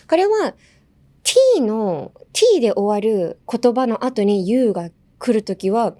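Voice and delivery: usual speaking voice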